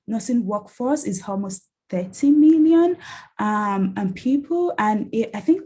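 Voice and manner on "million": million said high and loud, with weight; emphatic stress. Real, not acted. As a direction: lean hard on million to make the number land. Stressing the size of the workforce.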